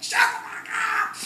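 high, loud, hoarse mock scream; mock rage at dogs. Patient parent turns on the dogs, hammed-up scream. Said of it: "So I'd be like this very patient ... then I turn to the dogs".

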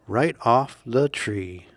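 ordinary delivery, nothing marked

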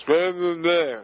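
ordinary talk, nothing marked

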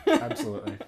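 laughing. Real, not acted. Agreeing through laughter.

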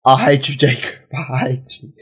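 low, soft grumble; mock-bitter, dropping very soft; deflated resentment. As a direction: trail down into quiet defeat. Defeated after Jacob fails him.

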